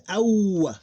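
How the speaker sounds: ow drawn out, gliding from a to u; diphthong. Shown as a demonstration. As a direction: stretch the glide in ow. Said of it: "what the diphthong here".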